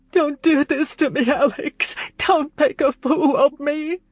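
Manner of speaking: sobbing plea. A narrator's reading. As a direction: beg him between sobs. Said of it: "she sobbed".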